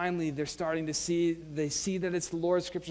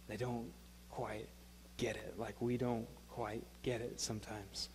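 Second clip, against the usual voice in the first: soft confession; 7 semitones lower, 13 dB quieter than usual. Real, not acted. Preacher softly confesses shared failing.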